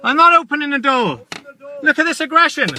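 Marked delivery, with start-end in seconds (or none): none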